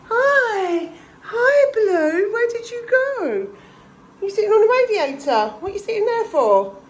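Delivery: high sing-song voice for the cat; cat talk. Real, not acted. Vlogger greets her cat on the radiator, high cooing pet voice. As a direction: coo up high at your pet.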